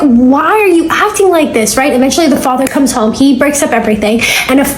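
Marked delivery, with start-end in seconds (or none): 0.0-1.8 slow, drawn-out disbelief; bewildered plea. Retold or imitated. Jordan staring at her raging stepmom, slow and bewildered. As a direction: ask it slowly, stunned. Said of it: "Jordan's just looking at her like".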